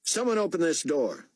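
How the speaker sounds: high, sharp commanding bark; barked order. A narrator's reading. Buck demanding the guards open the cage, barking. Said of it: "Buck barked".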